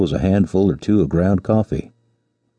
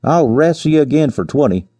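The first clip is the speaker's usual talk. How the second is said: mild angry shout; 9 semitones higher than usual. A narrator's reading. Givens challenging the lion that floored him, mild shout. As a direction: read a shouted dare without full volume. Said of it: "shook his fist at the lion and shouted".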